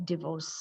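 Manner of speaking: divorce as dih-VORCE; short pure I in divorce, said softly; pure vowel. Shown as a demonstration. Teacher correcting the diphthong, divorce as dih-VORCE. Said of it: "what we need over there is a pure vowel".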